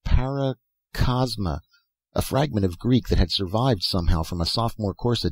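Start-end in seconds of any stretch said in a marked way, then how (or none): 0.1-1.6 drawn out slowly, puzzling over the word; slow wondering repeat. A narrator's reading. Dan repeating the strange name, slow and wondering. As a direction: let Paracosma linger slowly in wonder.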